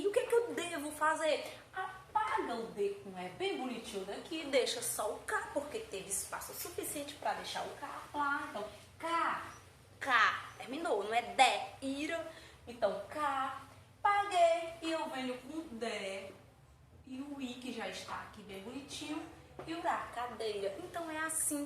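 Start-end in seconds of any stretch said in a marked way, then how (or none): none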